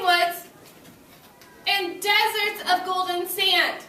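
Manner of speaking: slow, high, loud storybook reading; teacher reading the earth book to class; reading aloud to children. Acted. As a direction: savor each word for the class.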